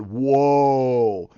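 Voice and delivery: low, loud, drawn-out "whoa"; imitated awestruck viewer. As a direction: stretch it out like a stunned audience. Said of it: "you're going".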